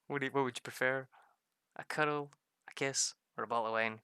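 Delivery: playful offer. Retold or imitated. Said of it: "I'm like".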